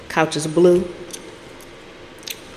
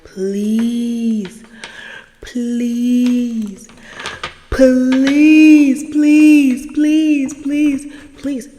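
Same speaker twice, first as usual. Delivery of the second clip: high, drawn-out imitated pleading; hammed-up repeated plea; begging; 6 semitones higher, 5 dB louder, much slower than usual. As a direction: beg over and over, swelling then fading.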